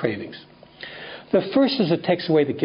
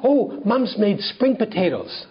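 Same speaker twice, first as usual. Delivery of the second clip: squealing with delight; 6 semitones higher, slower than usual. Retold or imitated. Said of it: "started to squeal".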